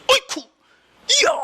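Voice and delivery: very high, hammed-up exclamation; overdone fake amazement. Shown as a demonstration. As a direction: overact your admiration in a squeal-high voice. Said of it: "너무 오버하면 안 믿어". (너무 오버하는 과장된 감탄)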